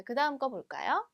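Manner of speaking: ordinary delivery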